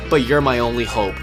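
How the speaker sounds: usual speaking voice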